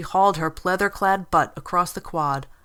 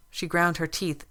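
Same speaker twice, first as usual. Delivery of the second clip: ordinary delivery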